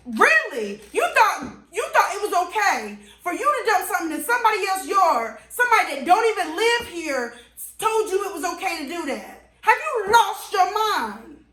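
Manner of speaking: high, heated retold scolding; belligerent. To the neighbor, retold belligerent scolding. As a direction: tear into him, incredulous. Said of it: "I'm acting belligerent at this point".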